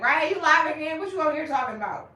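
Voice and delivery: loud, high teasing call-out; mock viewer shout. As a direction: yell it like a fan spotting you live. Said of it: "Instagram, like".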